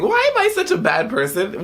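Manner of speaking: high-pitched lament. Real, not acted. Vlogger regrets letting Chloe die, high-pitched lament. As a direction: pitch leaps up in dismay. Lamenting her in-game choice.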